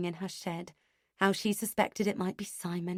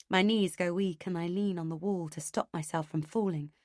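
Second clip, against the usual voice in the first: plain reading voice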